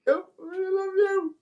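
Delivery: put-on high, slow, wailing I love you like a weepy drunk; pleading with mum through tears; drunken tearful I love you. Drunk crying self on phone to mum.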